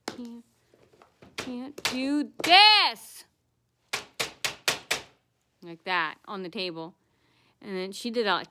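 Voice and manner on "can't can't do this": mocking whiny clerk. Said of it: "she's slamming".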